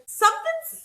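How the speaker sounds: mock alarmed cry, very high and loud; Ryan. Host acting out Ryan raising the alarm, urgent and high. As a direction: pitch leaps up in an imitated outburst.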